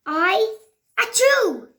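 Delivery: high loud sneeze. Acted. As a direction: burst into a sneeze.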